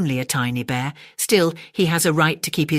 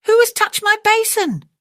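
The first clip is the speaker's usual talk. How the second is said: very high, loud, strange cartoon bear voice; mother bear growl; 6 dB louder than usual. Mother bear echoing father, very high loud squeal. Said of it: "Mother Bear growled".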